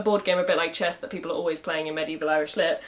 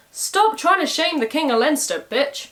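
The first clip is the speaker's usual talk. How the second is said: raised, higher voice, scolding her; a harsh telling-off, voice pitched up; angry scolding; 7 semitones higher than usual. A narrator's reading. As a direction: lay into her for shaming the king. Congal berates the king's wife.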